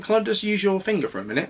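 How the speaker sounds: a hint of a nurse asking politely; imitated nurse. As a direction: raise your pitch a little to quote a nurse. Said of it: "And she was like".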